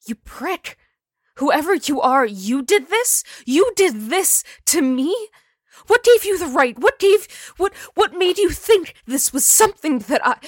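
very high, very loud outrage; furious screaming. Acted. Jennifer's ghost shrieking at the man who killed her. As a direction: scream the accusation at him.

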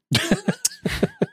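squeaky giggle; a sudden high squeal of laughter; high-pitched laugh. Real, not acted.

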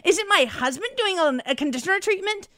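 high disgusted voice, slowing on the stinky smell; mock disgusted caller. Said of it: "You think S N L's gonna like this?".

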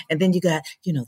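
ordinary talk

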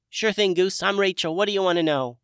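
tiny high fairy voice; bright, small, high-pitched sprite. A narrator's reading. Rachel the sprite speaks.

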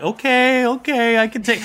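high-pitched owl character; owl taking the hint. Acted. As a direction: back off in a high voice. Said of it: "takes the hint".

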